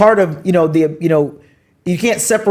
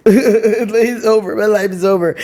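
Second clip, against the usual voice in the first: mock crying; 2 semitones higher than usual. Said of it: "I was crying like".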